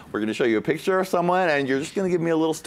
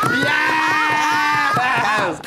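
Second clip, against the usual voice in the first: high, slow, drawn-out cheer; hammy celebratory holler; mock triumph; 9 semitones higher than usual. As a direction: whoop it up like a showman. Host celebrates the shady joke.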